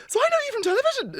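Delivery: very high, loud retold question; voice up an octave. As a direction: shoot the pitch right up, imitating amazement. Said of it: "my voice went up an octave".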